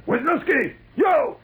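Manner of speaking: high, loud name and answer; roll call shouts. Retold or imitated. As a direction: bellow the names down the line. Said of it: "he keeps going on".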